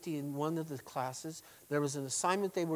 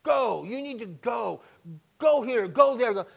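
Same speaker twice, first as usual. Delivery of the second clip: pounding the word Go; emphasize go; 6 semitones higher, 7 dB louder than usual. Shown as a demonstration. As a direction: Go punched higher and louder every time. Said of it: "we want to emphasize the word go".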